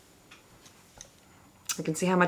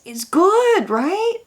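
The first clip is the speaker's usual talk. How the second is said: excited squeal; 12 semitones higher, 4 dB louder, much slower than usual. Real, not acted. Delighted by powder.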